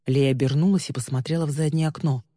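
ordinary delivery, nothing marked

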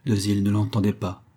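usual speaking voice

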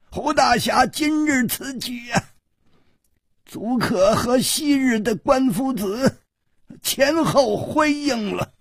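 put-on high-pitched old gentleman, praising after a chuckle; high, theatrical old-timer's voice; genial old man. A narrator's reading. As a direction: play a chuckling old elder, voice pitched well up.

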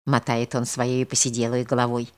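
usual speaking voice

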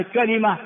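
كلمة with kasra on the lam; original form. Shown as a demonstration. Teacher recapping correct variants, "kalima". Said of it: "هذه كلها لغة صحيحة".

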